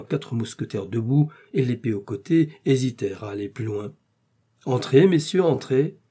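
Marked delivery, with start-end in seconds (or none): 4.6-6.0 raised, louder invitation; hearty cry, pitched up; calling out. A narrator's reading. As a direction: call the guards in. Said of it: "cria D'Artagnan".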